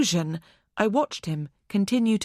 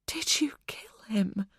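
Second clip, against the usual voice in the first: soft, breathless whisper of dread; frightened whisper; 2 semitones higher, 5 dB quieter than usual. Acted. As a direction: barely dare to ask it aloud. Hostage asks killer, terrified.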